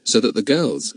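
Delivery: usual speaking voice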